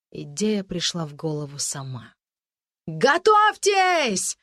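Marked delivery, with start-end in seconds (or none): 2.9-4.4 very high, drawn-out battle cry; full-voice shout. Acted. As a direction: bellow the order, long and high. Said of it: "во весь голос прокричала Анжелика".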